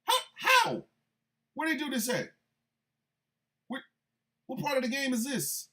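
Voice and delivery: can't believe what he's hearing; squeaky incredulous outrage; disbelief. Real, not acted. Incredulous reaction.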